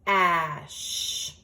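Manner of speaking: Cash stretched out slowly, sound by sound. Shown as a demonstration. Teacher says the word slowly for the class. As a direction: draw out Cash so each sound is heard. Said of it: "Let's say it slowly first".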